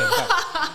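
loud, very high cackle; teasing laugh. Real, not acted. Guest teases host about being older, loud laugh. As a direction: burst out laughing.

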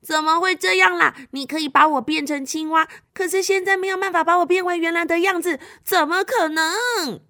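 high, mock-crying wail of complaint; acted upset little girl; tearful protest. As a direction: whine in dismay, stretching the last words. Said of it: "小美青蛙就不停地哭啊哭".

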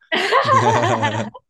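high squealing laugh; shrieking laugh. Real, not acted. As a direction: burst out in a shrill laugh. Joke about bleeping out.

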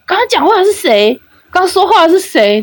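high, startled retold question; shocked asking. Gamers asking who spoke, in high startled voices. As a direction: sound stunned at the new voice.

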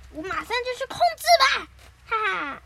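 loud, high cartoon glee; gleeful eagerness. Nobita rushes to try the control gadget, gleeful. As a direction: crow it with an eager laugh.

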